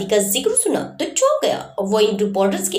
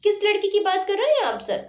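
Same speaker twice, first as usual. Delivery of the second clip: startled, higher pitched question; surprised question; 10 semitones higher than usual. A narrator's reading. Servant startled by his boss's slip, surprised. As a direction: ask it caught off guard. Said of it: "बहादुर चौंक कर बोला".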